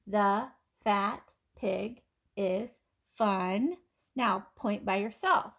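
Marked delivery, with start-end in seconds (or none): 0.1-3.8 very slow, word by word; slow pointing read. Shown as a demonstration. Teacher reads slowly as kids point to words. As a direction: pace each word for pointing. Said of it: "now point with me".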